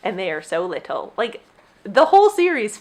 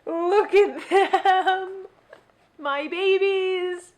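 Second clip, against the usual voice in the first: high and very slow cooing; slow high-pitched tender cooing; 10 semitones higher, much slower than usual. Real, not acted. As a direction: coo at them like your babies. Cooing over tiny books.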